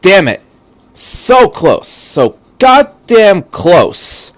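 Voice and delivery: high loud angry cry, last words dragged slowly; frustrated outburst. Acted. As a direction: curse the near miss. Misato slammed her fist.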